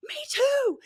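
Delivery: gasp, then high, loud exclamation; retold excited recognition. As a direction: re-enact a thrilled outburst of recognition. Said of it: "and I was like".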